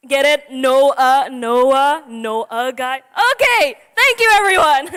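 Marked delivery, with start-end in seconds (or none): 3.3-4.9 high, loud hammy thanks; mock grateful. Teen preacher thanking the crowd in a high loud voice. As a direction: act like the joke killed.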